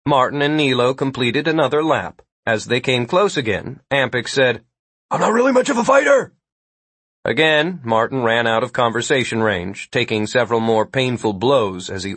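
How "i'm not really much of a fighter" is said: fast, high-pitched protest; calling out. A narrator's reading. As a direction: call it out hurriedly to someone running past. Calling out to man running past.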